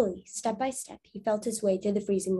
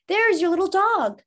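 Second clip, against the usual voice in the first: much higher, louder excited voice; excited child; 10 semitones higher, 6 dB louder than usual. Acted. Boy spotting the dog across the stream, high excited call. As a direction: point it out eagerly. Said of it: "called one of the boys".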